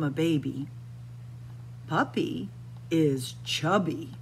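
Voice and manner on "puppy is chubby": ordinary delivery, nothing marked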